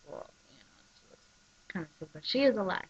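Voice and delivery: normal talking voice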